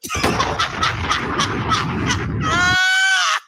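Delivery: real high-pitched wheezing laughter; high cackling laugh. Real, not acted. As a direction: crack up helplessly, long and high. Cracking up at juke joint band.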